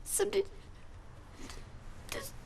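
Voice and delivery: fighting back tears, breaking into whispers; crying. Acted. Lonely woman confessing to Bill through tears. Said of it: "I don't know why I'm crying".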